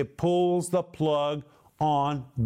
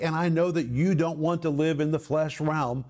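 usual speaking voice

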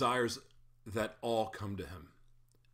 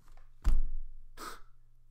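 long weary unvoiced sigh; loud weary sigh; heavy sigh; 10 dB louder than usual. Real, not acted. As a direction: let out a deep tired breath. Heavy sigh before reflecting.

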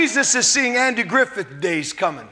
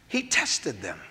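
dropped to a low hush; quiet; 12 semitones lower, 10 dB quieter, slower than usual. Acted. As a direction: let it fall almost to nothing. Preacher repeats line softly.

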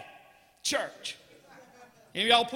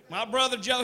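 ordinary talk

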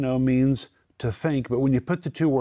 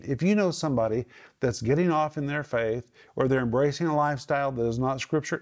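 ordinary talk

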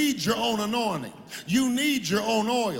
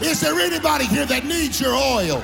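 loud, sung whooping climax, pitched higher; preaching at full force; 3 semitones higher, 4 dB louder than usual. Acted. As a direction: ride the organ and call for a witness.

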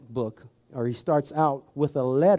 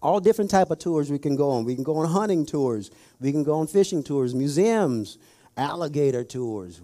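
normal talking voice

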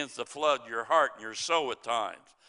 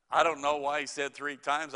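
ordinary talk, nothing marked

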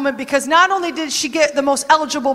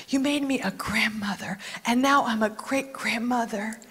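lower, soft, fading to a whisper; hushed awed prayer; 4 semitones lower, 9 dB quieter than usual. Acted. Ruth in awe of her great-grandson, hushed to a whisper. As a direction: murmur it in quiet wonder. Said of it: "she's like".

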